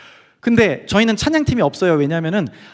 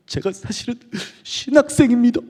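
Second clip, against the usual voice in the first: choking back tears; 5 semitones higher than usual. Retold or imitated. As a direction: imitate barely holding in sobs. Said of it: "울음을 겨우겨우 멈추더니". (울음을 겨우 참으며 고백하듯)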